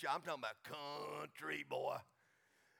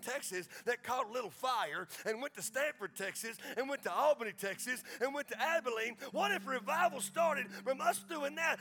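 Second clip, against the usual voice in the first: louder, higher preaching build; rising preaching; 6 semitones higher, 6 dB louder than usual. Real, not acted. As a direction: preach it up to a fired-up climax.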